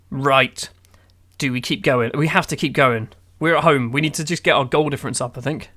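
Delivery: ordinary delivery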